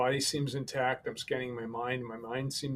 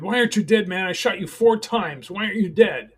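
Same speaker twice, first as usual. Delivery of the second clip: panicked high yell, much louder; retold shout; 7 semitones higher, 10 dB louder than usual. Gunman yelling at the man he shot, high loud disbelief.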